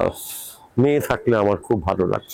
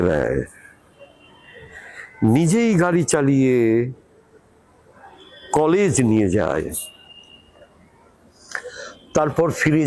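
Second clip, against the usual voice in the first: poem recitation. Acted. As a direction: recite it unhurriedly, letting each line fall at the end. Recites own poem after announcing it. (ধীরে, থেমে থেমে কবিতা আবৃত্তি)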